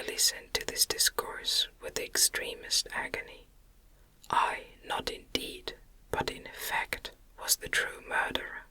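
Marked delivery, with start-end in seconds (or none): none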